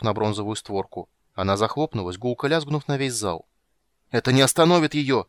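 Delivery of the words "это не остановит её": loud, higher terrified cry; terrified shout. A narrator's reading. Eben seeing the door close on the ghost, terrified shout. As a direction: scream it in horror. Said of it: "в ужасе закричал Эбен".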